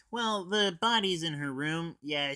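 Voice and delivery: usual speaking voice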